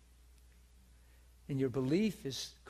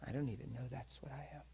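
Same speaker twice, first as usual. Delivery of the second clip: quick hushed whisper, no voice; 8 dB quieter, faster than usual. Retold or imitated. To the angry pastor, a quick hushed whisper. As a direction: breathe it out as a stunned aside. Retelling confrontation, quoting self.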